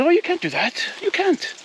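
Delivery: a high, hammed-up attempt at an Italian accent, which she admits she can't do. Italian boyfriend objecting to pineapple pizza, in a bad Italian accent. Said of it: "I can't do an Italian accent".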